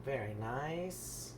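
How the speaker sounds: slow, soft, encouraging praise. Real, not acted. Praising Evan as he pours the syrup, soft and slow. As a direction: gently praise the careful pouring.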